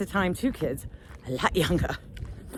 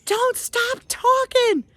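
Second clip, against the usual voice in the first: very high, slow drawn-out holler; mock screaming; 13 semitones higher, much slower than usual. Lost woman to distant kid, mock screaming. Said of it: "I'm screaming at this kid".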